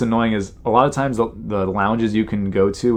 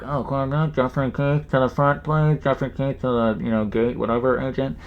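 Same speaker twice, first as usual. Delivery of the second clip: higher, garbled gate-agent announcement; put-on PA voice, pitched up; mock airport page; 3 semitones higher than usual. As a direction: imitate a mumbled paging call over the airport speakers. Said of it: "the Delta agents page me, you know, sometimes they're like".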